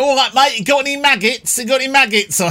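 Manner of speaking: hammed-up Essex bloke on the riverbank; [name]'s Essex accent. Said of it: "he goes very Essex".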